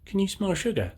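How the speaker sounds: put-on very high-pitched baby mole; high childlike pleading question. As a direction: squeak the question like a tiny child. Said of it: "Baby mole says".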